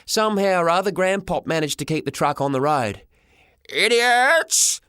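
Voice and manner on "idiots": high, loud shout at the fleeing car; old man yelling, furious; angry yell. A narrator's reading. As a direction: bellow it after them in fury. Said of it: "he yelled".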